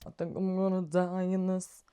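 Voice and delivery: slow, hammed-up TikTok sound line; quoting a TikTok sound in a put-on voice; TikTok quote voice. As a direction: drawl it like the viral clip. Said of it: "Stupid TikTok reference for you".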